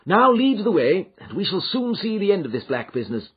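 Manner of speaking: detective rallying his friends; eager Holmes. A narrator's reading. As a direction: urge them on with eager resolve. Holmes voiced by narrator.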